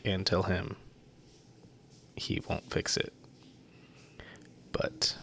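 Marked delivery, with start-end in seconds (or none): 2.2-5.2 voiceless joking whisper; whispered aside. Acted. Host teasing about the website, whispered. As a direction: drop to a whisper for the punchline.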